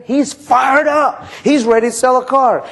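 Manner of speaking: excited manager talking up his salesman; hyped-up pitch. Acted. Manager hyping salesman to F&I guy.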